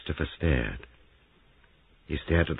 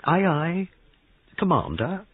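put-on high, childish character voice; childish mockery; 13 semitones higher, 4 dB louder, slower than usual. A narrator's reading. Christopher answering Giles's order, high and childish. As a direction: pipe it up in mock obedience. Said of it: "gave a high, childish giggle".

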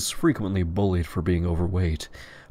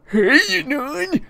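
very high louder greeting; friendly character greeting; 5 dB louder, slower than usual. Acted. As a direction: chirp it casually. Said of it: "says in a perfectly normal voice".

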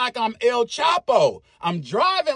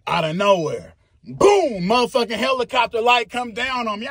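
ordinary delivery

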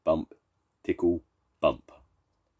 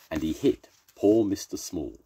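ordinary delivery

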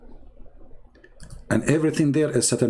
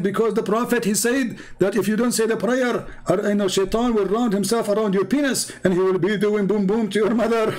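much higher, eager quoted voice; high-pitched mimic of the caller; imitating Abdul; 8 semitones higher than usual. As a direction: play the earnest caller explaining.

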